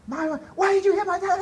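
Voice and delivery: loud, very high imitation of an outraged child; daughter confronting mom. Daughter runs up to her mom, imitated shouting high. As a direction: shout it like a kid in protest. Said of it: "she just lets her have it".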